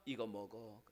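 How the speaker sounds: God softly offering Elijah food; gentle offer. Acted. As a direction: hand it over tenderly. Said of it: "하나님 뭐라고 합니까".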